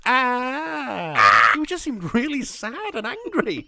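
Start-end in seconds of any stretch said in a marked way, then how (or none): 0.1-1.5 imitating a loud guttural scream, higher. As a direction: let out a sad, angry neighbour's yell. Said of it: "guttural noises of just".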